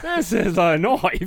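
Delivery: put-on loud, slow Crocodile Dundee Australian accent. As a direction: drawl it in a big Australian accent. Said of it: "in an Australian accent".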